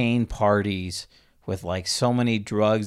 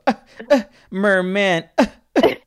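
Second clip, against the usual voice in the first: high, loud hammed-up coughing around merman; mock her delicate cough, much higher and louder; mocking Zoolander cough; 10 semitones higher, 5 dB louder than usual. Coworker mocking her cough at work, high hammed-up Zoolander style. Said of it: "make fun of me Zoolander style".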